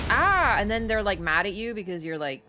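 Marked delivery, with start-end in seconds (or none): 0.1-0.6 sudden loud yelp, pitched up; mock startled shriek. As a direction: jump out of your skin at the noise. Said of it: "and then you're like".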